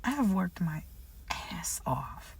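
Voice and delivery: breathy whisper of effort. Real, not acted. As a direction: whisper it like a secret. Quiet repeat of her effort.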